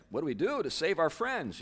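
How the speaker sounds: high, panicky plea for help; mock panic, voice jumping up high; frantic hobbits. Hobbits begging how to save their friends, mock panic. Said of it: "the other hobbits are like".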